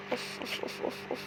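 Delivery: whispering in suspense while fleeing; tense whispered puffs. Real, not acted. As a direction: hold your breath and whisper it. Tense moment in game.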